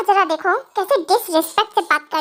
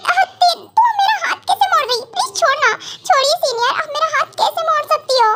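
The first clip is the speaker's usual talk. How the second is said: fake pain; 6 semitones higher than usual. Junior fakes pain, begs.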